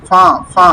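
"ফা, ফা" as two separate nasal syllables; nasalised "ফা, ফা"; from the nose. Shown as a demonstration. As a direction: send the vowel of "ফা, ফা" through the nose. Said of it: "নাক থেকে".

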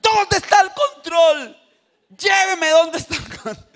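very high, loud acted demand; insistent questioning. Grandfather pressing toddler for the remote, insistent high call. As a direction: press the child loudly in a high voice.

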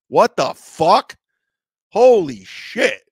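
slow, loud, drawn-out disbelief; stunned. Retold or imitated. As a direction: stretch it out, floored by the news. Stunned reaction to lifetime ban.

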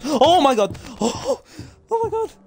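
high gasping startled cry; startled shriek. Real, not acted. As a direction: jump and shriek. Sudden scare in chase.

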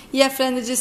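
ordinary delivery, nothing marked

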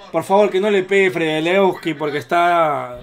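ordinary delivery

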